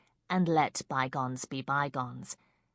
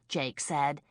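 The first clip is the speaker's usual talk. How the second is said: usual speaking voice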